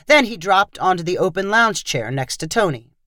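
ordinary delivery

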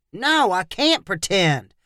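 ordinary delivery, nothing marked

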